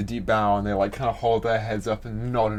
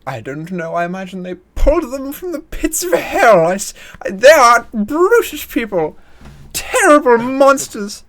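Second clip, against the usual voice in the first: much higher, louder scandalised dowager; outraged society lady; 11 semitones higher, 7 dB louder than usual. Acted. Rich lady to Akan, much higher scandalised outrage. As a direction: sneer it out in a high, loud posh squeal.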